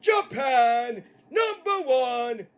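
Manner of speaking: high, slow, shouted character voice; Terry. Acted. Asked about being a hardcore legend in Japan, high slow boasting holler. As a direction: draw it out in a proud holler. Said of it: "Terry, you gonna be your".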